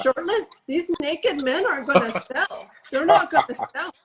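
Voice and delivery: mother's high-pitched Minnesota protest; Minnesota accent. Retold or imitated. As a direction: imitate a disapproving Minnesota mom. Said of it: "Minnesota accent".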